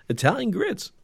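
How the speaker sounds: high, put-on teaser voice for the next episode; hammed-up announcer tag line; mock announcer. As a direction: tease it like a cheesy promo.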